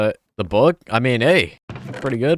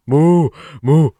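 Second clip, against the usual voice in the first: loud, high mooing like a cow; 5 semitones higher, 8 dB louder than usual. Pretend. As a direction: moo to call the cow. Imitating cow to lure it.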